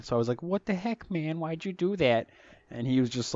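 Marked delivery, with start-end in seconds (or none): 0.4-2.3 higher, kiddish squeak on the same retort; clean kid version. Retold or imitated. Eleven-year-old me scolding Mitch, in a higher, childish voice. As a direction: replay it as the polite boy who won't swear. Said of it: "back then that was forbidden".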